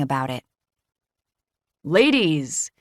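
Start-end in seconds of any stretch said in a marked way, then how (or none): 1.8-2.7 lightly voiced big booming man. A narrator's reading. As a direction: call out to the ladies, hearty. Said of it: "comes Harrison's big voice".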